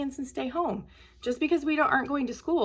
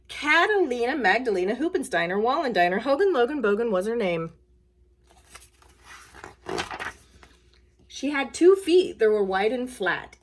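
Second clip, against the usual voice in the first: put-on bouncy, sing-song read-aloud for kids; louder, rhythmic picture-book reading; reading to children; 5 dB louder than usual. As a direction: read it like a lively storytime.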